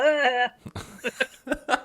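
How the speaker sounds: high mocking "ah"; taunting cry. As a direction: jeer from the getaway car. Mimics taunting cry while driving off.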